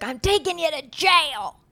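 high, slow put-on threat; drawn-out, hammed-up warning; mock-threatening dad. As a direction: imitate a dad's joking threat. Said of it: "my dad would threaten us as kids".

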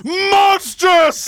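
outraged shout. Acted. Jealous husband repeating his horror, outraged shout. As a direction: hurl the word out in horror. Othello enraged at the report.

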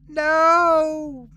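high, loud drawn-out scream; child's desperate high shout; anguished cry. A narrator's reading. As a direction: wail it out in horror. Child sees shoes thrown into fire.

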